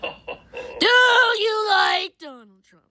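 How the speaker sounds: high, loud, very slow dragged question; hammed-up slow question. Pressing Talking Ben on Trump, very slow loud drawl. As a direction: stretch every word out mockingly.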